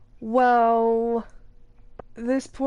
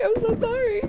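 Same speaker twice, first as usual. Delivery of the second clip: apologetic; 13 semitones higher than usual. Retold or imitated. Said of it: "I'm like".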